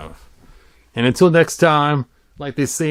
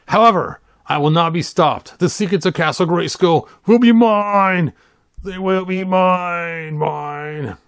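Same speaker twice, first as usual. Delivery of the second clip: villain voice; 6 semitones higher than usual. Acted. Reading Skeletor's threat aloud, grand villain voice. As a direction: gloat like an evil overlord.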